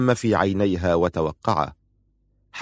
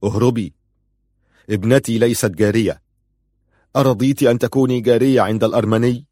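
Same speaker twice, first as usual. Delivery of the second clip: forceful father's command, building louder; 2 semitones higher, 4 dB louder than usual. A narrator's reading. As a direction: press harder with each phrase. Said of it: "فقال والدها في قوة".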